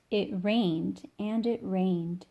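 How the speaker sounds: ordinary delivery, nothing marked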